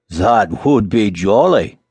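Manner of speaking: slow, relishing exclamation; delighted cry. A narrator's reading. Miller hearing of salad, slow delighted cry. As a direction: draw each word out gladly. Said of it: "cried the miller".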